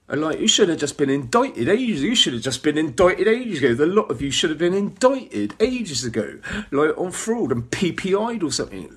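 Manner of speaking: angry rant. Real, not acted. Ranting at officials.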